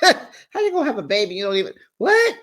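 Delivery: quick, high-pitched disbelief, mimicking a friend; incredulous. Friend reacts to hearing no "I love you" yet, acted incredulous. Voicing Erica's baffled questions.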